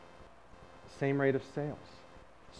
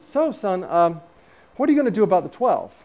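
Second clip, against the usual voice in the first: loud, high-pitched imitation of a father; father's voice; 6 semitones higher, 9 dB louder than usual. Father questioning his son from bed, loud raised voice.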